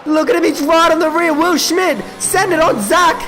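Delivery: ordinary talk, nothing marked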